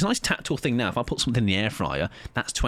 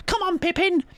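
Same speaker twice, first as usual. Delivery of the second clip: put-on much higher, sing-song kids' TV presenter voice; lilting Come Outside presenter calling her dog; Auntie Mabel impression. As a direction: call out like a cheery children's show host. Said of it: "I think that's her accent, right?".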